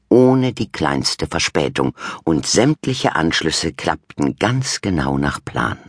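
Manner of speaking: ordinary delivery, nothing marked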